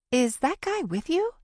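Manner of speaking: a woman driver asking quickly; driver's voice. A narrator's reading. Driver asks, voiced by narrator.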